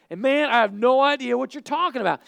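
defensive, high outburst; exasperated denial. Acted. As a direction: push the accuser off loudly. Acted second denial by Peter.

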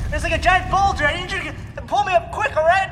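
loud, high, panicked calls; urgent shouting. Acted. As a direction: yell up urgently for help. Boulder coming, calling for help.

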